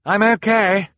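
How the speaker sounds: high, loud call across a room; calling back. Acted. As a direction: call it back loudly to reassure. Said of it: "Violet called back".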